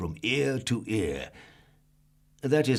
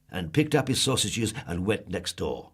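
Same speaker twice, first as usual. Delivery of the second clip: plain reading voice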